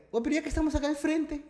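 high-pitched mock-innocent mum voice; imitating suggesting mother. Mum proposing to check on her son, mock-innocent.

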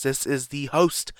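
ordinary talk

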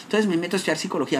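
ordinary talk, nothing marked

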